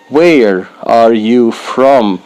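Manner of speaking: "Where are you from?" said slowly and evenly; slow careful model. Shown as a demonstration. Teacher to beginners, slow careful model. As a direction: spell it out slowly for learners. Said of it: "slow model then natural".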